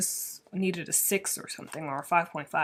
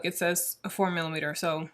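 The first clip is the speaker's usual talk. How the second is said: usual speaking voice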